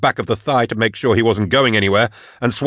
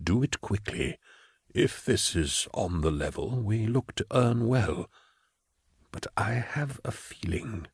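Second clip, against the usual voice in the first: hushed; 12 dB quieter than usual. Acted. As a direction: keep it hushed and slow. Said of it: "Cyrus whispered to him".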